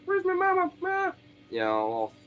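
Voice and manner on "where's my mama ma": very high, tiny kitten voice; imitating a kitten crying for its mother; frightened kitten voice. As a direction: squeak it like a lost kitten. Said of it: "freaking out 'cause it's like".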